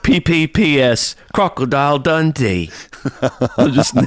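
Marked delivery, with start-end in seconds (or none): 0.0-2.7 high, slow, laughing Aussie announcement; giggly, hammed-up Australian; mock Australian accent. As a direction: stretch it out in cartoon Australian. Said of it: "thought of the last thing Australian".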